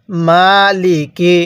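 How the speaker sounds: মালিকি with মা held long and লি কি short; stretched first syllable. Shown as a demonstration. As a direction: draw out মা, keep লিকি quick. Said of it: "মিমের মধ্যে টান". (মালিকি: শুধু মা-তে টান, বাকিগুলো ছোট)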